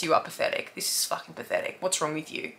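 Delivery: whispered harshly to herself; self-scolding whisper. Retold or imitated. Alone in the dark, berating herself in a whisper. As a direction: hiss the insults at yourself under your breath. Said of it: "really hard on myself".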